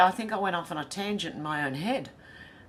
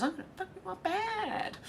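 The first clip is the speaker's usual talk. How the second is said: much higher squawky parrot impression; 13 semitones higher, 5 dB quieter than usual. Host retelling the Scary Movie parrot, in a high parrot voice. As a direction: mimic a cheeky talking parrot. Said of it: "where the parrot goes".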